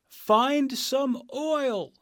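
a stuck tin man begging, high and slow; rusted Tin Man. Acted. Stuck in the rain, the Tin Man begs Dorothy for help, high and pleading.

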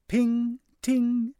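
high bright ping ting; magic chime. Acted. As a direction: sing out the magic sparkle sound. Magic sound effect.

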